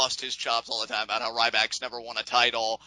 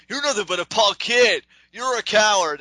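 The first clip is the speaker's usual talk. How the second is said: loud, high-pitched taunting yell; hammed-up confrontational shout; acted taunt; 9 semitones higher, 7 dB louder than usual. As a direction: hurl the insults like a wrestler. Acts out Axel confronting Reigns.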